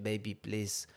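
lightly voiced Israeli accent, slow; a hint of an Israeli accent pleading. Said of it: "his little Sabra Yiddish accent".

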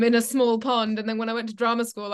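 normal talking voice

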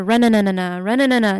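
moped engine sputtering noise; imitated stalling engine. Aunt keeps trying to start the moped, imitated engine noise. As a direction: mimic a motor that will not start. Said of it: "You know, you would hear".

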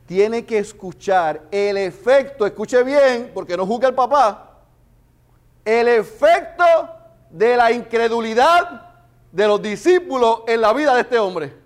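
loud, high, slow preaching; full-force preaching. Acted. Preacher presses the congregation on unbelief's effect, loud preaching. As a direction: drive the point home from the pulpit.

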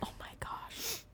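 an awed, breathless whisper. Real, not acted. Friend reacting to the drawings, whispered in awe.

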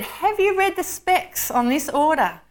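high, emphatic retort; exasperated Lord. A narrator's reading. Lord answering a doubting angel, exasperated. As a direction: push back on the angel, voice lifted.

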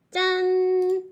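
high, loud ta-da reveal; booming prize announcer. Acted. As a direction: burst out with a showy ta-da. (크게 외치는 경품 발표)

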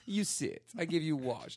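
put-on high, halting Italian-accented English; Italian barber. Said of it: "I got my hair cut in Italy".

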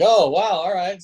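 retelling being taken aback; impressed surprise. Retold or imitated. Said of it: "And I'm like".

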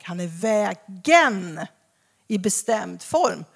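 "vägen" drawn out slow and weighty; "vägen" stretched, definite form stressed. Shown as a demonstration. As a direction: lean hard on "vägen". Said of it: "inte att han är en väg".

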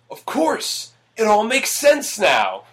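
high, slow mock eureka; hammy movie epiphany. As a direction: overact the sudden realization. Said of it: "Which is said verbatim".

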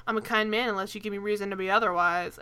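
lightly voiced old-timey leading man; a hint of a 1940s movie star. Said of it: "of course he says it in a shitty nineteen forties voice".

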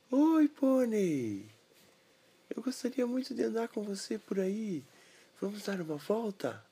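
put-on high boy voice, whispered middle; little boy voice.

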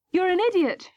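an upset mother's shout, understated; angry shout, held back. A narrator's reading. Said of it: "shouted Mum".

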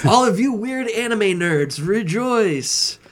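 loud and high, hammed-up herald; excited proclamation. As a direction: cry it out like a town crier. Mock proclamation to anime fans.

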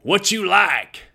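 coach's high, drawn-out Texas drawl. Retold or imitated. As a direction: stretch it out in a high Texas accent. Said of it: "He had that Texas drawl".

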